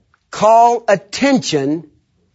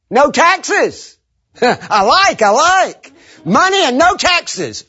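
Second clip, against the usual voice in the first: delighted soldiers; 9 semitones higher, slower than usual. Retold or imitated.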